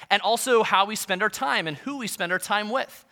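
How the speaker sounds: ordinary talk, nothing marked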